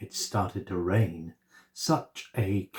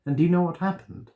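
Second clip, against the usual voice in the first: fast, high teasing question; storyteller question; 7 semitones higher, much faster than usual. A narrator's reading. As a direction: tease the listeners, quickly. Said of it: "asks the listeners".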